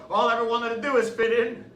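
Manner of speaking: ordinary talk, nothing marked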